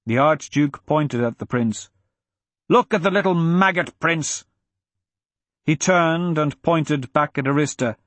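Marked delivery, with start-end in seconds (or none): 2.7-4.5 high-pitched scornful rant; Braga, bellowing. A narrator's reading. Braga pointing out the prince, scornful bellow. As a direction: spit scorn in a raised pitch. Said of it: "Braga bellowed".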